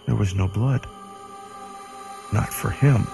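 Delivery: usual speaking voice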